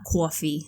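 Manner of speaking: "coffee" with a drawn-out Northern vowel; slow, stretched "coffee", northern style; northern accent. Shown as a demonstration. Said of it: "We say coffee".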